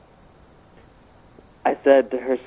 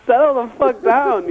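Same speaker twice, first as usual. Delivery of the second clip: retold exasperation, pitched up; exasperated retort; 8 semitones higher than usual. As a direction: play at telling her off, higher. Retold retort to angry date.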